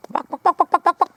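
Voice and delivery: high clucking like a hen; chicken clucking imitation. Calling the chickens.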